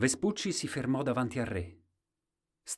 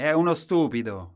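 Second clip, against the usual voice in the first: higher, much louder jeer; loud, raised voice of the townsfolk; people's loud judgement; 7 semitones higher, 10 dB louder than usual. A narrator's reading. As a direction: call it out loudly like the crowd. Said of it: "diceva la gente".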